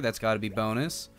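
ordinary delivery

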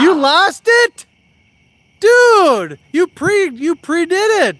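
loud, high disbelieving shouts at a buddy; real exasperated shout at a fishing buddy; exasperated yelling. Real, not acted. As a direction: yell at him for blowing the catch. Friend just lost a hooked fish.

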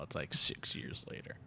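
dramatic trailer whisper. Acted. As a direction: breathe it like a title card. Whispered movie title card.

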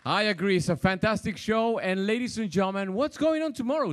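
loud, upbeat stage host; upbeat cruise director. Real, not acted. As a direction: hype up the crowd after the show. Cruise director hosting stage show.

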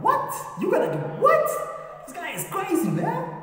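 very high, squeaky incredulity; stunned disbelief. Acted. Onlookers hear David threaten the giant, incredulous. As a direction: shoot way up in mock astonishment.

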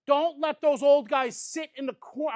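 ordinary talk, nothing marked

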